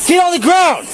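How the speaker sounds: yelling commands at suspect; shouted surrender demands. Real, not acted. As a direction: bark the order at full volume. Officer ordering suspect down.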